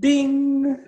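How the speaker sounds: high sung bell chime; bell ding. Pretend. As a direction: ring out like a bell. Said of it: "ring that bell for our second death".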